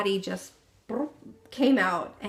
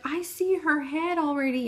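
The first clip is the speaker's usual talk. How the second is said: higher-pitched imitation of an excited mother; raised pitch, storytelling volume; retold excited announcement; 6 semitones higher than usual. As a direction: lightly act out mom's excited discovery. Retelling mom's startled announcement.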